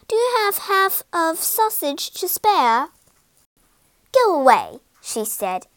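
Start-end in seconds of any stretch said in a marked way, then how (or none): 0.1-2.9 put-on high, polite boy's plea; polite request. A narrator's reading. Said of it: "called Jack politely". 4.1-4.8 louder, curt dismissal; dismissive order. A narrator's reading. Giant's wife sends boy off.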